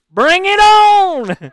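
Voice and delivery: long, high, drawn-out shout; bravado holler. Retold or imitated. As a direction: holler it like a fearless challenge, stretching the words. Boastfully accepting haggis challenge.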